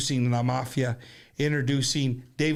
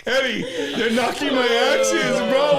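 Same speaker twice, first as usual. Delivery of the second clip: laughing; 8 semitones higher, 6 dB louder than usual. Real, not acted. Mock complaint to friend.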